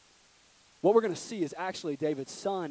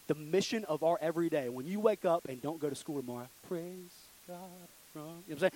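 ordinary talk

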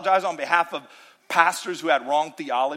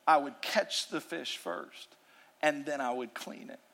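ordinary talk

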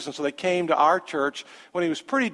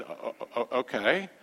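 a quiet, halting stammered okay; stunned stammer; 6 semitones lower, 8 dB quieter than usual. Retold or imitated. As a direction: caught off guard, stumbling to agree. Stunned by the daughter's request.

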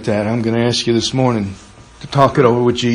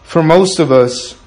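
loud, ringing pulpit preaching; full-force preaching; 5 dB louder than usual. Acted. Pastor preaching boldly to the congregation, loud and fervent. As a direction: preach it out to the whole church.

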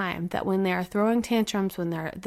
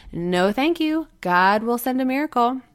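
higher, louder, smug refusal; mock-pious stubborn man, raised pitch; 4 semitones higher, 4 dB louder than usual.

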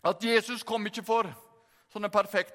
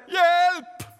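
loud, high acted yell; cry for help; 7 semitones higher, 6 dB louder than usual. Peter calls to the brothers as he sinks, shouted plea. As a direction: holler it out like a drowning man. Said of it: "Og Peter ropte".

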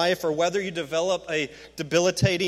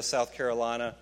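normal talking voice

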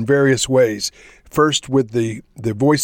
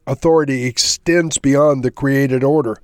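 ordinary delivery

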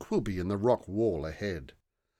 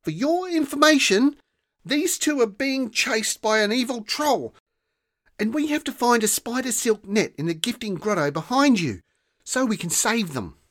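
put-on much higher, louder, exasperated child; frustrated girl; 14 semitones higher, 6 dB louder than usual. A narrator's reading. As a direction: burst out in frustration. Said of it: "Rosa's frustration at this was clear".

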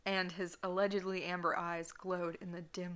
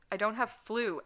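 a raised, higher cry of protest; shrieking; 4 semitones higher, 6 dB louder than usual. Acted. Edward shrieks back at Draco, raised and high. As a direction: cry it out, indignant and loud. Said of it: "Edward shrieked".

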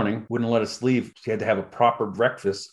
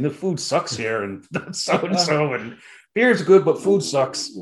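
cheeky jab; 6 semitones higher, 5 dB louder, slower than usual. Retold or imitated. Retold cheeky banquet speech.

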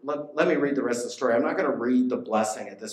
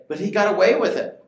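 much higher and louder, playing the skeptic; mock objection; 10 semitones higher, 9 dB louder, faster than usual. Preacher voicing a listener who doubts justice, loud mock protest. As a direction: throw out the doubter's protest loudly. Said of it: "You might say".

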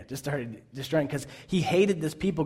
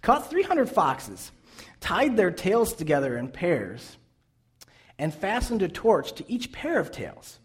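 higher and slow, each phrase weighed; slow emphatic reading; 5 semitones higher, slower than usual. Real, not acted. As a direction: read it slowly so it sinks in. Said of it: "just pause for a second".

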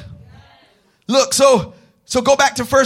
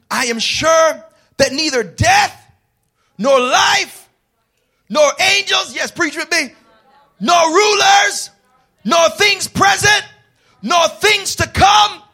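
full-force preaching; 5 semitones higher, 3 dB louder than usual. Acted. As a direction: proclaim every "nor" like a rallying cry.

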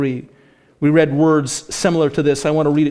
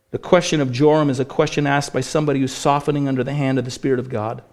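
ordinary talk

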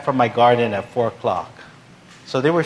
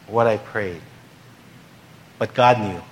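ordinary delivery

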